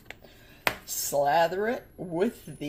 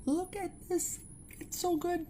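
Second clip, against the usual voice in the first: much higher delighted squeal; excited squeal; 12 semitones higher, 3 dB quieter than usual. Real, not acted. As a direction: gush over the food up high. Showing food, delighted.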